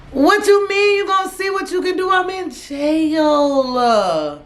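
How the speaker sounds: can't believe grandma's answer; outraged disbelief. Real, not acted. To her grandma over the phone, outraged and drawn-out.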